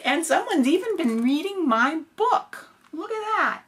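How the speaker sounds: high little cub voice; baby bear voice. Acted. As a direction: squeal like a tiny bear cub. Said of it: "the baby bear said".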